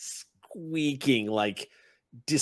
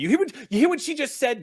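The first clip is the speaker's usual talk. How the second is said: much higher and louder, fast; exasperated shout; 13 semitones higher, 6 dB louder, faster than usual. Acted. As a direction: fire it back in disbelief. Red cap exasperated at goblin.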